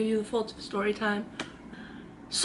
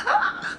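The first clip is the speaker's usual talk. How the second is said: imitated high scream; short, very high mock scream; bloody-murder scream. Friend screaming after her fall, imitated shriek. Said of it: "Like, Bloody murder".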